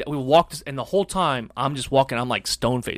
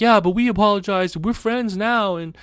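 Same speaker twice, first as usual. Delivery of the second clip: mock earnest kid, pitched up; eager kid; 6 semitones higher than usual. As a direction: sweet-talk the principal like a scared first grader. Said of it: "the kid, of course, is like".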